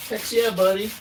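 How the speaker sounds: loud, high excited yell; hyped-up shout. Pretend. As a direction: belt it out like a cheer. Catchphrase repeated, louder and higher.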